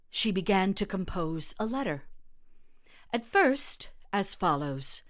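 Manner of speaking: ordinary delivery, nothing marked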